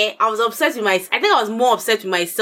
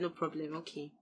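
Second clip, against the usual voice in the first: much softer, letting it go; resigned quiet; 4 semitones lower, 21 dB quieter than usual. Retold or imitated. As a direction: say it softly, as if giving up. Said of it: "After that, I said".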